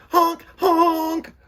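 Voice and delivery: high, loud honk like a small horn; little truck honking loud and high at bullying trucks; honking cry. A narrator's reading. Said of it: "cried Little Truck".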